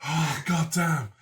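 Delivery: winded after laughing hard. Real, not acted. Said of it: "my, like, mouth hurts".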